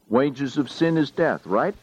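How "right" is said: normal talking voice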